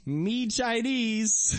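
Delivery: slow, drawn-out broken-English "Me Chinese"; Chinese guy impression. Shown as a demonstration. Comedian demoing a hallmark impression, slow and drawn out. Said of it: "I'm gonna do an impression of a Chinese guy".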